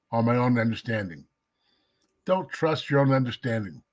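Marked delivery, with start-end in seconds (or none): none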